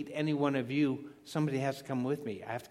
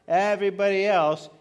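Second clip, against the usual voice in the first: Everybody else, with else hit hard and loud; stress on else; 5 semitones higher, 9 dB louder than usual. Real, not acted. Preacher to congregation, hitting else loud in Everybody else.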